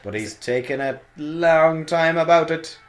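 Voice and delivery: slow, high, announcer-style call; wrestling commentator. Acted. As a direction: drag it out like a ringside announcer. Continues the mock commentary.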